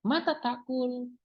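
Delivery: mata takul slow, pitched high as a question; question tune. Shown as a demonstration. Teacher showing students a question, slow and high. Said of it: "kalimat tanya".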